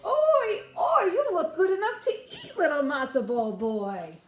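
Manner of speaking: very high, loud gossip's cry; excited Yenta. Acted. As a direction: squeal at the tasty boy. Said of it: "The Yenta dropped her basket and cried".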